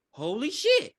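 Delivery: a stunned man seeing gold; amazed. Retold or imitated. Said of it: "and he's like".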